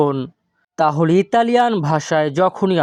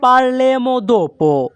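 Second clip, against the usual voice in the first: "parleremo dopo" very slow, higher and drawn out; slow model phrase; 6 semitones higher, much slower than usual. Shown as a demonstration. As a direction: stretch "parleremo dopo" out for learners. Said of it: "এই বাক্যের Italian হবে". ("parleremo dopo" খুব ধীরে, উঁচু সুরে টেনে)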